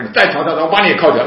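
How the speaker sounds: loud, high retold warning; angry threat. Airport officer threatens the protesting speaker, angry threat. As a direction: threaten loudly, imitating an irate officer.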